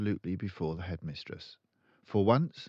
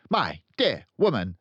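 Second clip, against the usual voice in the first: loud, high barking headmistress; booming tyrant headmistress; barking Trunchbull; 10 semitones higher, 10 dB louder, faster than usual. A narrator's reading. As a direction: bark it out like a bully. Trunchbull rants.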